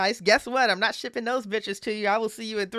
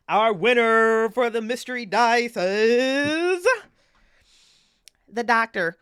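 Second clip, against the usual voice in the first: slow, louder build-up to the winner's name; drawn-out winner announcement; 2 semitones higher, 5 dB louder, much slower than usual. Real, not acted. Streamer announcing the mystery dice winner to chat, slow and louder. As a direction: stretch it out like a game show host.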